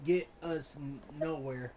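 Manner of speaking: ordinary talk, nothing marked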